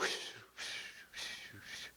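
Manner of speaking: breathy imitated whooshing air; whispered whoosh. Shown as a demonstration. Showing the congregation the prayers' soft whooshing. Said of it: "You can hear this little whoosh".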